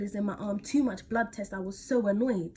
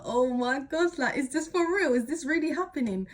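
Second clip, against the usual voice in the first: retold panic; 5 semitones higher, 3 dB louder than usual. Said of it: "I was like".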